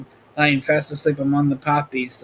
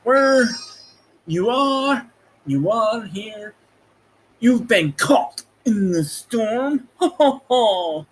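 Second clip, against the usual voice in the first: higher, slow, ominous announcer delivery; dramatic catchphrase; 7 semitones higher, slower than usual. Acted. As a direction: make "caught in the storm" sound like a trailer line. Podcast catchphrase performed.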